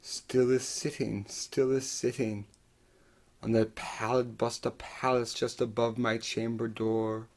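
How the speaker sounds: very soft, near-breathless closing; hushed. Acted. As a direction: let it sink almost to nothing.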